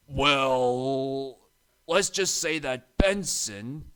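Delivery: slow, hesitant stammer. A narrator's reading. Harry dodging the question about Benson, stammering. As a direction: stall nervously, caught out. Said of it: "stammered Harry".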